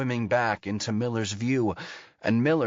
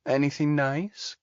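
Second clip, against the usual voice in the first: put-on baby doll voice; 5 semitones higher than usual. A narrator's reading. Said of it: "Mercy drawls in a baby doll voice".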